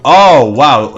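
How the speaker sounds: sudden loud oh wow; surprised exclamation. Real, not acted. Caster catching a play he missed, surprised and loud.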